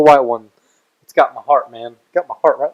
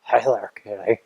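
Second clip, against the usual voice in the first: whispered hello to a kitty; breathy unvoiced greeting; 3 semitones lower, 8 dB quieter than usual. Joins the cat greeting.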